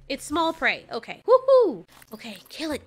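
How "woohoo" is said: high, loud cheer; excited whoop. Real, not acted. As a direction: whoop with glee. Cheering on a hunt of prey.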